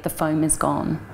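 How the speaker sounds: usual speaking voice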